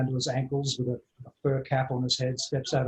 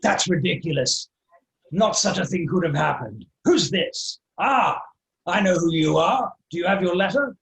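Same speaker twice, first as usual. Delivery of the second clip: loud, blustering, higher-pitched character; big acted bluster, raised in pitch; indignant tavern man; 4 semitones higher, 6 dB louder than usual. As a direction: dismiss it with pompous indignation. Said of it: "He's like".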